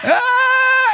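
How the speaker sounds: high drawn-out "ah"; hammed-up high wail; mock itchy cry. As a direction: cry out as if itching all over. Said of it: "everything itching".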